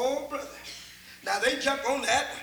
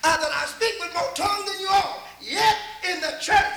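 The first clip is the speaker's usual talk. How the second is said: loud, higher preaching of the verse; 6 semitones higher, 6 dB louder than usual. Acted. Preacher reading Paul to the church, louder and higher. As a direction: lift the scripture up high.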